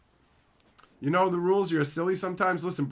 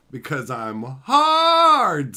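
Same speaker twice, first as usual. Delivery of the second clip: drawn-out boast; 2 semitones lower, 9 dB louder, much slower than usual. Acted. Boasting to chat.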